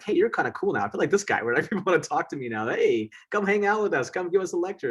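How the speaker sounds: put-on old classmates at reunion suddenly fawning over him; eager colleagues. Colleagues now courting him.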